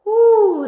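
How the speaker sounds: high, loud whoop of relief; relieved whoop. Real, not acted. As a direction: let out a happy woo. Relief after a hard exercise.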